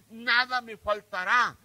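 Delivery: slow and loud, "nada" hammered; "nada" emphasized in a slow preached line; stress on nada. Shown as a demonstration. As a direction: drag out "nada me faltará" with force. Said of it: "está enfatizando aquí una palabra, nada".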